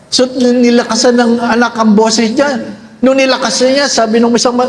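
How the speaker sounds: usual speaking voice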